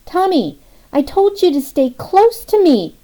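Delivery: put-on high, loud, frantic call to a runaway child; mother yelling. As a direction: shout after a toddler across the lawn. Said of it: "she yelled".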